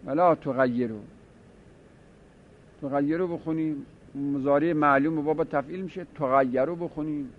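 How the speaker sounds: ordinary talk, nothing marked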